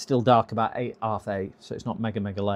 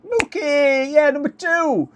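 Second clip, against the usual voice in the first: excited call; 14 semitones higher, 6 dB louder, slower than usual. Real, not acted. Excited call to viewer Mookie.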